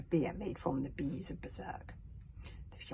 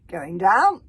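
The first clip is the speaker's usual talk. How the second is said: mocking taunt; 14 dB louder than usual. Alvin mocks trapped Hiccup.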